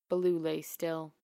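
ordinary delivery, nothing marked

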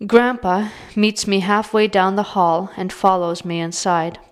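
ordinary delivery

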